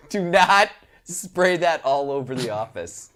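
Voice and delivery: normal talking voice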